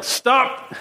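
sharp high cry of stop; rabbi's yell. Retold or imitated. Rabbi cutting off a student's recitation, sharp high yell. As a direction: bark the word like a stern rabbi. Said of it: "the rabbi would yell".

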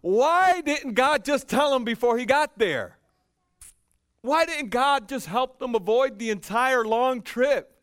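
high, loud and slow, pressing the question; preaching at full force. Acted. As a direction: preach it to the back row.

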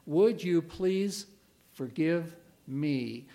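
"Would you please forgive me" spoken slowly, word by word; a slow, earnest model apology; slow deliberate plea. Shown as a demonstration. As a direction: take your time over each word of the request. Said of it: "the most difficult words to say".

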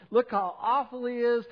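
loud, high, scornful jab; scornful Pharisee. Acted. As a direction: sneer it out as a self-righteous critic. Pharisees' scornful remark acted.

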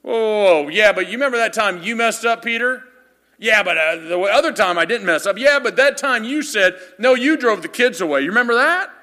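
loud, high needling jab; taunting disciple. Pretend. Disciple taunting Peter about his failure, loud and mocking. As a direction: rub his old mistake in his face.

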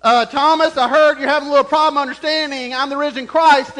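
loud, high, fast mock reproach; scolding. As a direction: imitate a pointed telling-off. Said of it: "and just scold him".

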